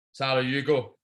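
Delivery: usual speaking voice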